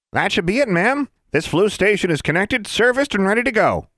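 a bouncy tiny tradesman voice; cheery little wizard. Acted. Small wizard introducing himself to the group, chipper. Wizard reports work done.

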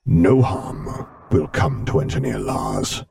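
slow, deep commanding rumble; cold, measured imperial authority; Darth Vader voice. Acted. Vader vow.

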